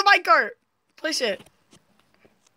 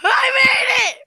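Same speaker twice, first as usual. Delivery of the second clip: triumphant shout; 8 semitones higher than usual. Real, not acted. Player announces finally making the climb, triumphant high shout. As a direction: stretch it out jubilantly. Celebrates reaching the top.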